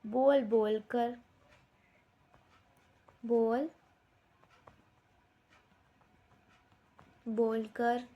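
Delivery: drawn-out words, long pauses between; slow dictation. Shown as a demonstration. Teacher giving children doubled words to write, slow dictation. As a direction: leave time to write after each word.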